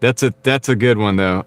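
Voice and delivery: normal talking voice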